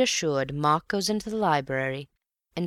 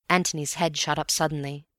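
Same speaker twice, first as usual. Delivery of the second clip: plain reading voice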